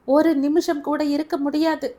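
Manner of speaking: ஒரு நிமிஷம் shouted high and loud; tearful shout. A narrator's reading. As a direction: hurl it out through tears. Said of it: "அழுது கொண்டே".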